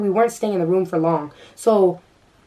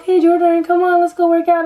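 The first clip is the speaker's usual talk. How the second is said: high, louder coaxing mimic of the friend; imitating Trinity; 10 semitones higher, 6 dB louder than usual. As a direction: chirp it like a cheerful friend pulling you away. Friend Trinity quoted, mock voice.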